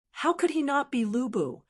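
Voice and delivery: plain reading voice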